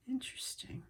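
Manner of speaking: thoughtful whisper. Real, not acted. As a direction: whisper it while puzzling it out. Quiet puzzling over fabric.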